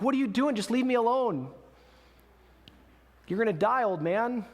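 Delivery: high, exasperated retold yell; mock yell back. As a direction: call back pleadingly without full shouting. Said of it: "and yells back".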